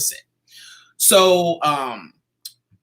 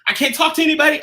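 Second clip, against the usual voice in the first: high, urgent retelling of a hurried exit; frantic rush; 11 semitones higher, 3 dB louder, much faster than usual. Retold or imitated. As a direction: brush people off in a hurry, pitched up. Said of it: "out of breath".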